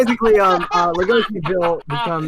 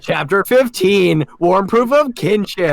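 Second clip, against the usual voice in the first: slow, louder title read; chapter announcement; 4 semitones higher, 5 dB louder than usual. Acted. Host reading the chapter title, grand announcement.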